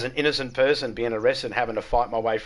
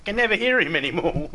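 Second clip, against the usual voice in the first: ordinary talk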